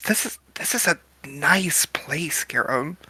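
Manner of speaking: quiet whisper to a dinner companion; soft, whispered compliment; hushed aside. Acted. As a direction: whisper it, impressed but discreet. Awed aside in fancy restaurant.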